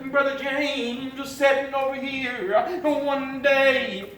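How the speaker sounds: loud, rising preacher's cadence, pushing each phrase; full-force preaching. Acted. Preacher exhorting the congregation about salvation, full-force preaching.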